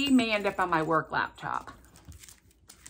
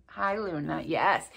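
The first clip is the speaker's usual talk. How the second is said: usual speaking voice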